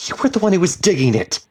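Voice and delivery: fast, high, loud yell; angry, rapid-fire shout; shouted accusation. A narrator's reading. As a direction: shout the blame into the dark. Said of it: "she shouted into the blackness".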